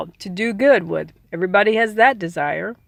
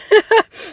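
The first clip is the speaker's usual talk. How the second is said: quick high laugh; a bright burst of laughter; short laugh; 11 semitones higher, 5 dB louder than usual. Real, not acted. Teacher laughing at her own aside, a quick laugh.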